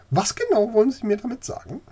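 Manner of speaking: high, fast and sharp; high-pitched lady losing patience; impatient old lady. Acted. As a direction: press him for a straight answer, quickly. Old lady presses for answer.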